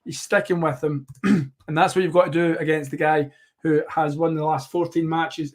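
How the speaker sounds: normal talking voice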